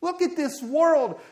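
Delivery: high, loud, drawn-out appeal to God; prophet's outcry. Acted. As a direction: cry out at the state of the world. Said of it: "And he's just saying".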